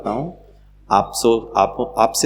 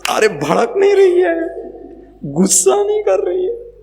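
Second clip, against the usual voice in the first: mock amazement pitched way up; astonished child; 4 dB louder than usual. Child marvelling that mother is not angry, mock-astonished high voice. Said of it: "मन में क्या सोचेगा".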